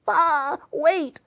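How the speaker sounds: high bleating call of a lamb. Acted. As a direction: cry it out like a lost lamb. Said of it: "The lambs call".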